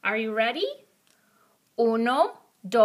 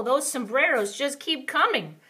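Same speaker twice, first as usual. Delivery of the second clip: ordinary talk, nothing marked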